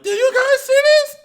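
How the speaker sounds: very high, loud shriek; excited shriek. Retold or imitated. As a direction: scream it to your friends. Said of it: "looked at all my friends like".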